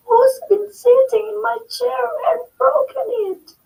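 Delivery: very high squeaky cub voice; squeaking Baby Bear. Acted. Baby Bear finding his broken chair, squeaking. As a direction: squeak it in distress. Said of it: "squeaked Baby Bear".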